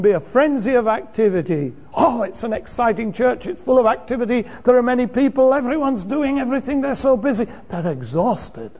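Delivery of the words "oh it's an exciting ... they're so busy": higher-pitched, breathless-with-enthusiasm mock praise; gushing churchgoer imitation. Churchgoer boasting about all the church's activity, mock gushing. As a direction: gush like an impressed church member. Mimics admirers of a busy church.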